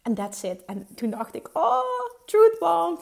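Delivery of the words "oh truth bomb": loud, high burst of delight; excited truth bomb. Retold or imitated. Recalling a coach's words, a loud high excited outburst. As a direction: blurt it out like a revelation.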